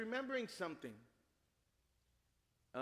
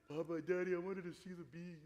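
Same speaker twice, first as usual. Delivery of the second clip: lightly voiced little boy. Lost child answers his father.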